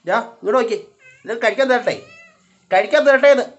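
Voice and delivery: high, loud, insistent offer; calling to a pet. Real, not acted. Calling cat to come eat. (ഉച്ചത്തിൽ നിർബന്ധിച്ചു വിളിക്കുന്നു)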